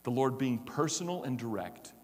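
ordinary delivery

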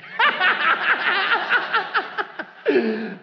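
real high burst of laughter; big laugh. Real, not acted. Preacher laughing at his own confession, loud high laugh. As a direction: let the laugh break out high.

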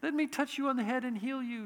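a hint of syrupy comfort; mild teddy-bear Jesus. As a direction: play a harmless nice-guy Jesus, just a touch. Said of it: "My Jesus would have walked by and gone".

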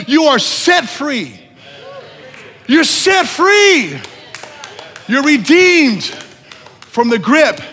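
loud, high, impassioned sermon climax; full-force preaching. Real, not acted. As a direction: proclaim it to the whole congregation.

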